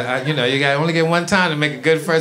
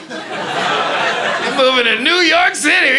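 slowed-down, higher impression of dad with a laugh in it; father's announcement; 8 semitones higher, much slower than usual. Father tells his son they're moving, drawn out and high. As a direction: stretch every word out like dad breaking big news.

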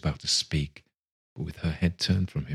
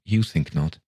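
struggling. A narrator's reading. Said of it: "he said with a struggle".

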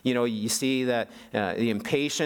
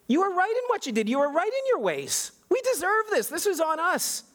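much higher, pleading confession; Daniel pleading with God, much higher and impassioned; impassioned prayer. Retold or imitated. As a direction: cry out to God, owning the blame. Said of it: "Daniel is saying".

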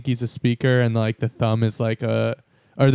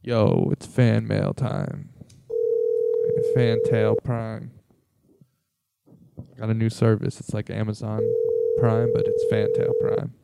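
announcer voice; 2 semitones lower, 3 dB quieter, slower than usual. Acted. Mock ad for Fantail Prime.

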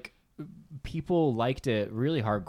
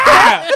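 mock scream; 16 dB louder than usual. Rider screaming on ride.

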